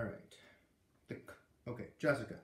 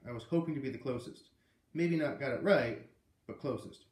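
ordinary delivery, nothing marked

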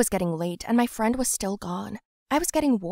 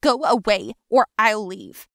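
loud threat; 7 dB louder than usual. Acted. Gina shouting in quarrel.

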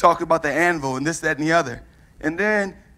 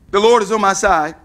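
ordinary delivery

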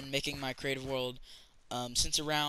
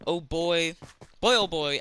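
excited; 3 semitones higher, 6 dB louder than usual. Real, not acted. Excited about decorating for Halloween.

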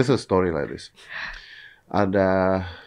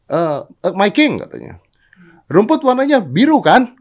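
high, loud eager donkey; 13 semitones higher, 8 dB louder than usual. Acted. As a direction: squeal up at the king. Donkey pleading to king. (keledai merengek kepada raja)

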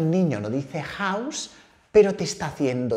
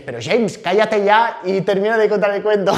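louder, rising exasperation, on the edge of laughing; mock impatient viewer; 6 semitones higher, 7 dB louder than usual. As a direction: playfully scold as a fed-up viewer. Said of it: "es que dices".

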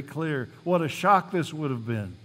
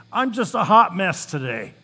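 louder, higher frazzled sigh of a line; exasperated; 5 semitones higher, 7 dB louder than usual. Retold or imitated. Assistant telling her pastor her day, exasperated. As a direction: blurt it out, fed up. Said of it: "and she'd say".

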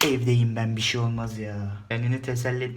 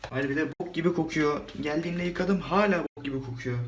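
ordinary talk

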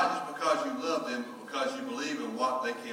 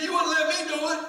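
high, loud whine; mock exasperated son; whiny son; 8 dB louder than usual. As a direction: complain to dad.

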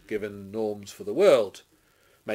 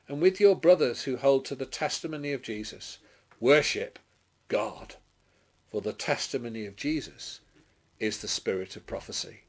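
raised, proclaiming voice that swells on the cries; dramatic scripture reading; 6 semitones higher, 7 dB louder than usual. A narrator's reading. Preacher reading Babylon's downfall to the congregation, declaimed. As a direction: declaim the prophecy with ringing force.

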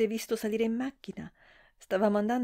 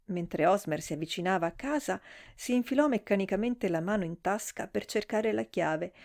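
plain reading voice

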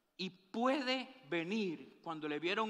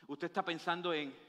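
normal talking voice

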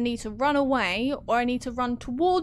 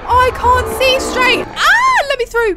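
loud, high terrified yelling while fleeing; panicked screaming; 10 semitones higher, 15 dB louder, slower than usual. Real, not acted. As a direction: scream in real panic as you run. Chased by monster in game.

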